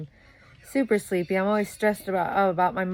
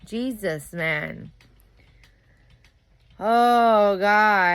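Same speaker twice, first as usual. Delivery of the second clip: loudly fed up; real exasperation; 3 semitones higher, 7 dB louder, much slower than usual. Real, not acted. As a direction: let out a big fed-up groan of words. Venting about boring, stubborn partner.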